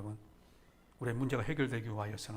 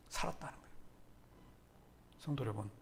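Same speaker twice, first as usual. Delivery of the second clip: ordinary talk, nothing marked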